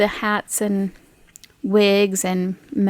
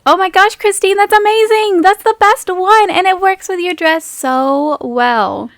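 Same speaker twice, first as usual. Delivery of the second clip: higher, gushing friend; excited Meg; 7 semitones higher than usual. Acted. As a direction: rave about the mask. Meg admires Christine's mask.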